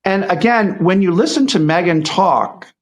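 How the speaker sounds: ordinary delivery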